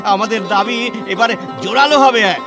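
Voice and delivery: high, exultant declaiming; jubilant discovery. Acted. Reformer finds the verse backing widow remarriage, jubilant. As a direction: cry out the find in triumph, pitch held high.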